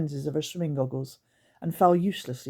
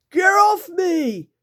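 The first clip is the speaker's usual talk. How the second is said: slow, loud, much higher creature shriek; 14 semitones higher, 10 dB louder, much slower than usual. Acted. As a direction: screech it as the basket lands. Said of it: "shrieked Scratch".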